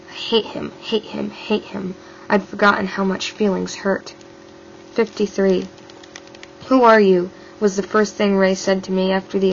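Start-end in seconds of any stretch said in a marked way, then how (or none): 0.1-1.9 unvoiced, slowing, repeated hate; intense whisper. A narrator's reading. 6.7-7.3 loud demand, stress on who; harsh shout. Acted. Abductor demands her identity.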